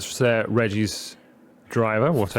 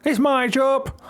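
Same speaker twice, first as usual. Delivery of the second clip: rising to a high, whiny claim; hammed-up protest; 9 semitones higher, 4 dB louder, slower than usual. Game character refusing to give up the job, hammed-up protest. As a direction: stretch the jibe, then jump up high. Acted quarrel over the job.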